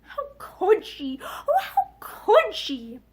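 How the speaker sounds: slow, drawn-out tearful lament; a slow, half-laughing cry of hurt; inner anguished cry. A narrator's reading. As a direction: ache over each word, slowly. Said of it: "she was crying out over and over again".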